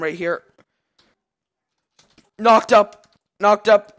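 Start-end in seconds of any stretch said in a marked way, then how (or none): 2.4-4.0 high, loud hype call; real excited callout. Real, not acted. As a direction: shout the play as it lands.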